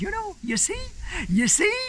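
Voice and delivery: told-you-so in an Irish lilt; Irish lady. Retold or imitated. Lady pointing out to Mark his wife was fine, Irish accent. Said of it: "the little Irish lady said".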